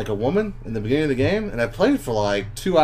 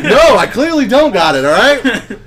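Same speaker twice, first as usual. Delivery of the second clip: loud, laughing protest; laughing admission; 9 semitones higher, 9 dB louder, slower than usual. Retold or imitated. Answering Heather while tearing up, loud laughing protest. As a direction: admit it through laughs. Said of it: "And I was like".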